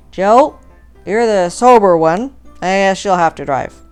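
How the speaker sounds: boozy order bellowed at a mate; drunken shout. A narrator's reading. Drunk Ben to Joe, the sober one, shouting tipsily.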